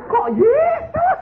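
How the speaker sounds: high, loud, drawn-out mock outrage; incredulous outcry. Husband objecting to the name of Jesus, incredulous outcry. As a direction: squeal the question in slow disbelief.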